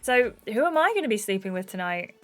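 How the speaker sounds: ordinary delivery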